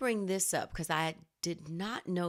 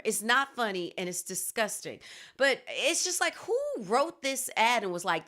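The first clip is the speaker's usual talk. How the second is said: ordinary talk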